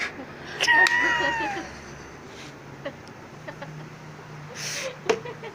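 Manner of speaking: high squealing burst of laughter; cracking up at her own punchline; shrieking laugh. Real, not acted. Teller shrieks with laughter at her own punchline.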